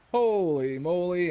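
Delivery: loud, high, slow New England drawl; mock New England accent; put-on New England accent. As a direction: ham up a New England holy moly. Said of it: "follow while the accent comes out".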